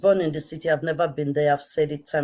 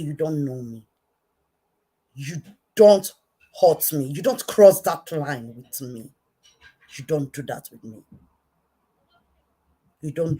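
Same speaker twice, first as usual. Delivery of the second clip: stern warning; 2 semitones lower, 4 dB louder than usual. Real, not acted. A wronged woman warns Daniel not to cross her, loudly.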